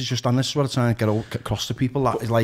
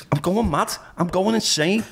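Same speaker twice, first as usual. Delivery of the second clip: higher-pitched mock desperate plea; acted desperation; 8 semitones higher than usual. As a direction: plead that you're losing it, pitched up. Acts out own paranoid desperation.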